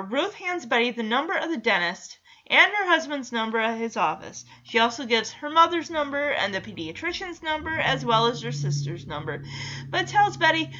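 ordinary talk, nothing marked

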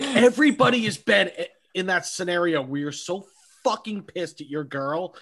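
ordinary talk, nothing marked

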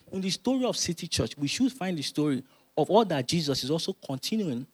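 usual speaking voice

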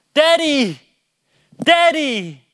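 high, loud mimicked call for a parent; drawn-out falling yell, imitating a son; imitated child calling. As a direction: call out like an insistent little kid. Imitates his son calling for him.

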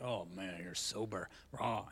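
low, soft, grave voice; deadpan mock solemn; mock serious sober guy. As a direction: play up how dull sobriety sounds. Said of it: "think of sobriety as all serious".

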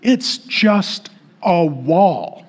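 very slow, each word spaced out; unimpressed, drawn-out correction; deadpan dismissal. Real, not acted. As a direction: drop back down and spell it out slowly. Deflating the mocked emotional pilgrim.

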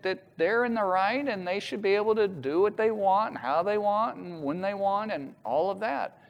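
normal talking voice

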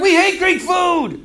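high, drawn-out group complaint; mock protest. Conquered people refuse Alexander's food, in mock protest.

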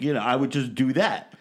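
ordinary talk

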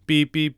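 high, even vocal beeps; two high beeps by mouth; imitated phone beeps. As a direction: mimic a cut-off phone line. Imitates dead phone line.

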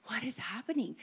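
whispering the bewildered question; whispered. Retold or imitated. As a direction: breathe it out, confused. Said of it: "Habakkuk is like".

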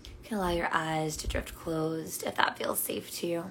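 calm meditation guide with long pauses; unhurried, soothing invitation to notice and breathe; guided meditation. Real, not acted. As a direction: guide them gently into their breath.

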